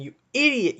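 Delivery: angry jab, cut short by second thoughts; exasperated outburst. Real, not acted. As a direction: lash out at the manager. Insulting Bergevin, then apologizes.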